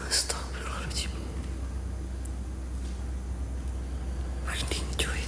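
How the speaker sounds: hushed, breathless prayer under the breath; scared whisper in the dark; frightened whisper. Real, not acted. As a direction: whisper it so nothing hears you. Scared in dark, hearing crying.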